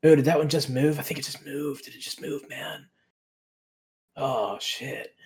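a lightly voiced dazed stoner, drifting up in pitch; stoner wonder. Santiago gawks at the cave, a hint of stoned awe. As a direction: marvel at glowing mushrooms, spaced out. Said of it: "he's just like".

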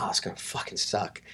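hushed, breathy inner groan; muttered dread. Retold or imitated. Traveler expecting nerdy trip mates, muttered dread. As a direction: mutter it under your breath. Retold glum thought about the trip.

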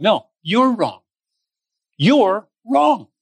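rising to a firm, pointed rebuke. Retold or imitated. Jesus rebuking the disciples in front of Mary, firm and pointed. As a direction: turn the accusation back on them, building. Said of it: "he's like".

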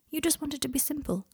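reassuring. A narrator's reading. Malfoy tells Harry he understands, softly reassuring. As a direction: soothe him gently, voice lifted and soft. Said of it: "his tone reassuring".

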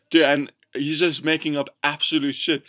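ordinary talk, nothing marked

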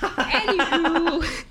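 high, slow, laughing anywho; silly drawn-out anywho. Acted. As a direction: stretch it out with a laugh.

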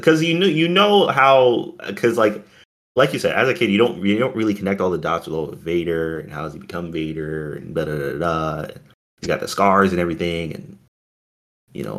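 ordinary talk, nothing marked

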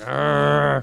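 loud frustrated growl; annoyed growl. Retold or imitated. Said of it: "he's like".

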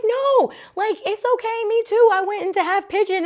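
very high-pitched consoling squeal, retold; gushing sympathy. Retelling how she comforted a bawling yoga neighbor, gushing sympathy. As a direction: gush reassurance far up in pitch.

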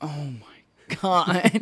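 very slow, stretched-out stunned "oh my God"; stunned mock. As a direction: drag it out in mock shock. Sam's stunned reaction quoted.

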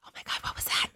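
breathless frightened whisper; scared whisper. Retold or imitated. Retelling the dark kitchen moment, whispered in fright. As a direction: whisper it like something's in the room.

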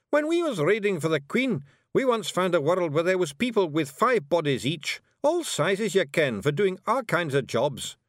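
high Scots voice telling a tall tale; boastful Scottish Feegle. A narrator's reading. Rob telling Miss Level of raiding adventures, boastful. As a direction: brag away, pitched well up, in Scots.

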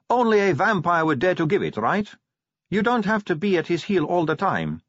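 high-pitched insistent advice; Sally, frank vampire. Acted. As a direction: press her frankly in a high voice. Sally advises Angua.

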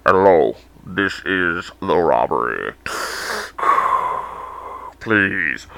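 hammed-up villain on a phone call; mock intimidating robber. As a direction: try way too hard to sound scary. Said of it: "that's the most intimidating voice".

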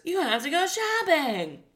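put-on high, loud kids' host proclamation; exaggerated sing-song children's presenter; DJ Lance. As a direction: announce it with hammed-up kiddie zeal. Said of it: "I think D J Lance says".

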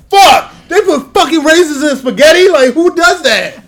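loud, slow outraged yell; outraged shout. Retold or imitated. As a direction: shout it in disbelief. Imagined outrage over raisins.

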